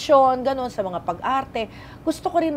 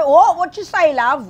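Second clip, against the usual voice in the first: put-on high, loud British cabbie accent; puzzled British taxi driver; 7 semitones higher, 8 dB louder, slower than usual. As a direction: query it like a baffled London cabbie. (taxi driver na Briton, hindi makaintindi)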